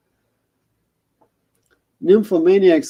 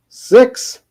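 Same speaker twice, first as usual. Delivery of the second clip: a high, louder puzzled squeak; 9 semitones higher, 8 dB louder than usual. Acted. Frodo, puzzled, repeats Shelob's number back. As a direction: jump up in disbelief at the number.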